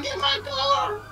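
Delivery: shrieked high, half-joking; mock shriek. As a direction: play the screaming granny for a laugh. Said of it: "You should be like".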